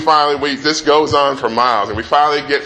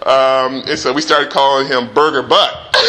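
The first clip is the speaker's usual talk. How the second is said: usual speaking voice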